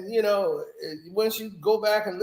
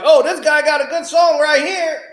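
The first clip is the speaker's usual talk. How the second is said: higher, louder excited mock elf; 6 semitones higher, 7 dB louder than usual. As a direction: shout it like a thrilled little worker elf. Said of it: "coming up like".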